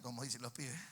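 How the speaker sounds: ordinary delivery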